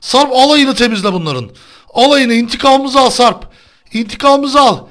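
high, loud victorious cheering; excited cheering. Real, not acted. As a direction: urge him on, triumphant.

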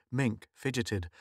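ordinary delivery

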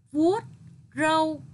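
loud, separated model of vuốt râu; emphatic vuốt râu. Shown as a demonstration. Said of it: "lưu ý đọc tròn môi ... âm R ở tiếng râu".

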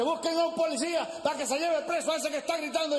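very high, chanted preacher's demand; outraged preacher. Acted. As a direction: keep the pitch up as you summon police. Preacher calling for police.

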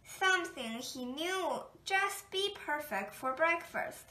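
plain reading voice

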